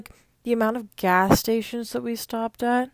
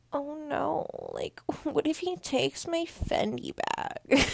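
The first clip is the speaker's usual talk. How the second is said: whispered mock fear; mock panic; 6 semitones higher, slower than usual. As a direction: fake scared whisper. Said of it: "then you're instantly".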